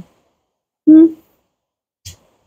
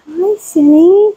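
high, slow pet voice; cooing greeting; 8 semitones higher, slower than usual. Real, not acted. Greeting goat Cindy, high cooing. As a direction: coo hello to a goat.

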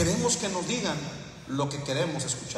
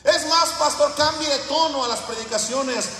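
much higher, louder mock demands to the pastor; mock churchgoer shouting demands; 10 semitones higher, 5 dB louder than usual. Pastor mocking his flock, loud high mocking churchgoer. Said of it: "Muchos de ustedes estarían bien contentos".

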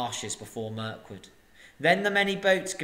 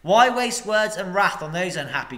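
scornful rebuke; 5 semitones higher, 7 dB louder than usual. Acted. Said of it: "said Bard".

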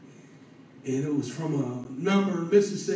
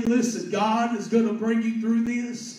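higher, urgent exhortation; impassioned preaching; 5 semitones higher, 3 dB louder than usual. Real, not acted. Preacher pleads with a man giving up, impassioned. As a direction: press the words home with rising force.

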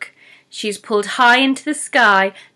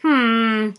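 troll grumble. Acted. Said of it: "grumbles the troll".

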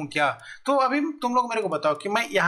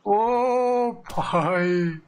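hugely drawn-out, awed exclamation; amazed exclamation; much slower than usual. Real, not acted. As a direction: stretch every vowel out in amazement. Amazed by the attack shown. (हैरानी से खूब खींचकर)

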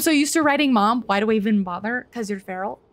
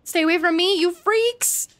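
being chased; 7 semitones higher than usual. Acted. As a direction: shout it while fleeing monsters. Fleeing enemies in game.

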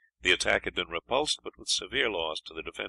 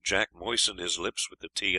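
ordinary delivery, nothing marked

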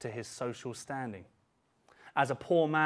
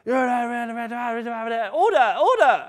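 loud high cry of order; imitating the Speaker; 8 semitones higher, 10 dB louder, much slower than usual. Preacher mimics Speaker calling the house to order. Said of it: "And it's like a playground".

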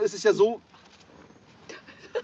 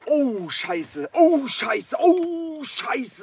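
disgusted shock; 8 semitones higher, much slower than usual. Real, not acted. Sees rotten fish.

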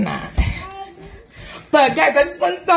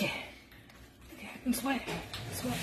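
much softer, trailing off; fading murmur; 20 dB quieter, slower than usual. Real, not acted. Telling viewers she's sweating, fading murmur. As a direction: let it fade to a murmur.

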